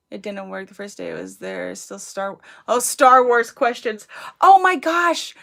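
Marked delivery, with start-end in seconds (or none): 2.7-5.3 sudden realization. Real, not acted. Suddenly remembers.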